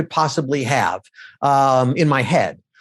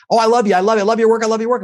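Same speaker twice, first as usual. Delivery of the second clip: a fan's louder, higher gush of adoration; mock-gush like an adoring stranger; excited fan gushing; 6 semitones higher, 4 dB louder, much faster than usual. Fans gushing on the street.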